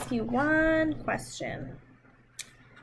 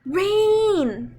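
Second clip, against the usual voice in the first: higher and louder, delighted; excited; 5 semitones higher, 6 dB louder than usual. Acted. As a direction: announce the rain like a happy surprise. Rain arrives after gasp.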